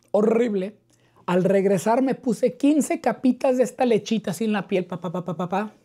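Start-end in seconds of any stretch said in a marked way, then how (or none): none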